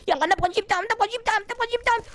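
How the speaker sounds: normal talking voice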